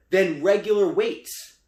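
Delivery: ordinary talk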